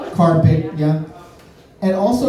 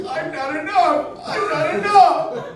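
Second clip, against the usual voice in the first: high, slowed wail of not enough; mock insecure wail; 14 semitones higher, slower than usual. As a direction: whine it high like a hurt ego. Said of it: "all I hear is".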